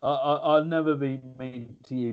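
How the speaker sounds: usual speaking voice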